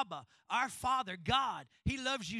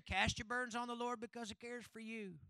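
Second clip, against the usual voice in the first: softer, run-through recital of a well-worn verse; familiar rote quote; 4 dB quieter than usual. Retold or imitated. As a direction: toss it off like an overheard saying. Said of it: "you're so used to hearing".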